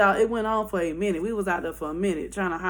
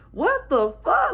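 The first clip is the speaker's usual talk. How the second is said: slow, higher, rising question; retold disbelief; 7 semitones higher than usual. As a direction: stretch it out, baffled. Both in the back, stunned.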